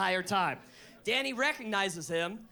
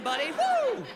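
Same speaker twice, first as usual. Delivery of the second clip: high, loud excited woo; dolphin whoop; 12 semitones higher, 5 dB louder than usual. Retold or imitated. Dolphin cheering after a fin slap. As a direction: whoop like a pumped-up dolphin buddy. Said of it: "Like, hey".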